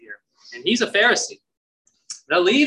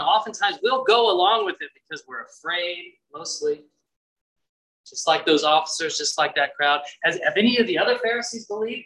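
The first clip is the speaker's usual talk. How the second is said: usual speaking voice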